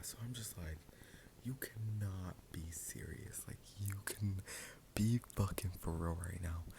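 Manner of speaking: soft whispered storytelling; ASMR whisper. Acted. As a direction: keep it hushed for the microphone.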